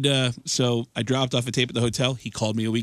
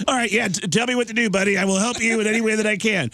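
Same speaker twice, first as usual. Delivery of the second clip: higher Tom Arnold voice, eager; eager Tom Arnold agreeing to help; Tom Arnold impression; 9 semitones higher than usual. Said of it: "And Tom goes".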